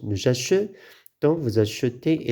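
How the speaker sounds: "achetons" and "achetez" with a rounded schwa after ch. Shown as a demonstration. Teacher showing the sound change, schwa in "achetons" and "achetez". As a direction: break it as a-che-tons, a-che-tez, neutral e. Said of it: "La prononciation se change".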